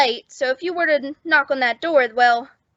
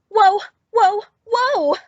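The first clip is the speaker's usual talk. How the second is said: rising, louder gasps of surprise; startled whoa; 7 semitones higher, 4 dB louder, slower than usual. Acted. Bellboy gawking at Maisie's new sweater, rising startled whoas. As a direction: caught off guard by what he sees.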